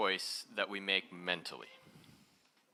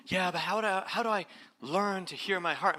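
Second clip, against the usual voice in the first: higher, louder pleading question; questioning student; 8 semitones higher, 6 dB louder than usual. Retold or imitated. Students ask how to hear the heart, a raised pleading mock voice. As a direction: imitate a puzzled student asking. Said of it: "they're going".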